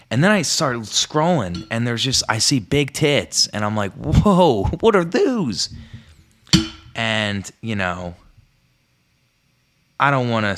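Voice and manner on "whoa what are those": high, loud amazed gasp; wide-eyed wonder. Retold or imitated. Kid amazed at images.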